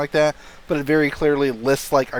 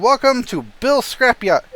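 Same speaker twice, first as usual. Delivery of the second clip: shopkeeper voice; 10 semitones higher, 4 dB louder than usual. Acted.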